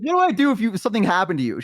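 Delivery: high, acted distressed reproach; upset scolding. Elf to her badly injured friend, acting upset. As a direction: imitate a frantic, worried telling-off.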